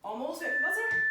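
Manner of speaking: loud, high coaching call. Real, not acted. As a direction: shout it over the music. Coach calling out over music.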